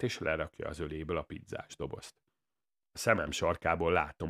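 plain reading voice

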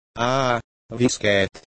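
lightly acted wail of torment; mild yell. A narrator's reading. Eric tearing at his beard from the itch, mild drawn-out yell. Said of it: "ordította Robinson".

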